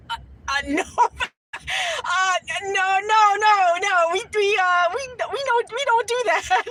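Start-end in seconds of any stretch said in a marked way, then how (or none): 1.6-6.6 flustered denial. Retold or imitated. Refusing an intimate question.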